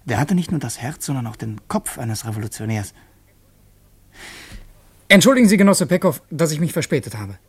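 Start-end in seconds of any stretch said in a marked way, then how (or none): 5.1-7.4 higher, louder and fast; hurried apology. Acted. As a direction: rush in with an eager apology. Breathless apology to leader.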